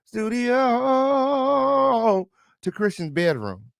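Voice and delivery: high, stretched-out disbelief; incredulous. Real, not acted. Host questions how they left the studio, incredulous. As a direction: hold the repeated word long and high, almost sung.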